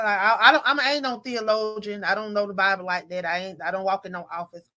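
ordinary delivery

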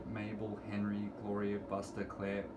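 plain reading voice

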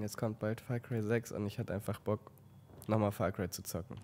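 ordinary delivery

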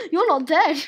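loud mock-outraged cry; loud, playful protest; playful exclamation. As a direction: call it out loudly, as a discovery. Exclaims at friend still alive.

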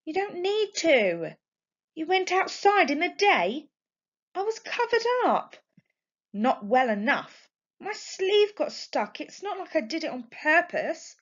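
put-on mother and teen daughter bickering; heated argument. A narrator's reading. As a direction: argue your corner with rising heat.

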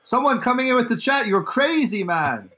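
mock streamer, pitch climbing on the exclamations; Carlos impression. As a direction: imitate an excitable streamer addressing chat. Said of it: "I can do a Carlos impression".

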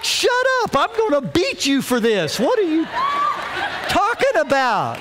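high-pitched, incredulous outburst; mock exasperation. Dad reacts to four-year-old's appeal, mock exasperated. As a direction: blurt it out in hammed-up disbelief.

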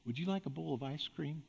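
soft, hushed offer; almost breathe it so only they hear; hushed parent dangling a treat; very quiet voice. Shown as a demonstration. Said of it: "say in a very quiet voice".

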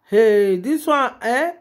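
ordinary talk, nothing marked

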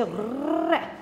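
a long trilled "r"; rolled Spanish r. Shown as a demonstration. Teacher showing how Spain says it, "r" strongly rolled. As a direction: trill the tongue on "r". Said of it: "roll the R".

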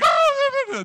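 high, loud shriek of alarm; mom's startled cry. Retold or imitated. Mom in the ambulance front seat, shrieking loud and high. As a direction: imitate his mother's startled scream. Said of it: "She's like".

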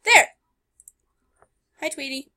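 usual speaking voice

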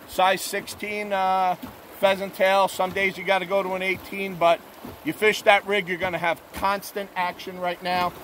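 ordinary talk

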